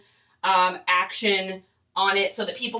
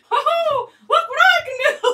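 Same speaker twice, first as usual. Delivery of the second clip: much higher, squeaky cartoon mouse, laughing; Mickey Mouse voice; 5 dB louder than usual. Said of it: "I didn't even know I could do Mickey Mouse".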